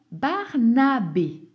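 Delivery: Barnabé slowly, in three separate syllables Bar-na-bé. Shown as a demonstration. Teacher correcting a dictation sounds out the first name, Barnabé split into three syllables. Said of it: "on a trois syllabes".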